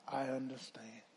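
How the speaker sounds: soft, low "I understand"; a tender hush of reassurance; Lord's gentle voice. Retold or imitated. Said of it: "I heard the Lord's voice say".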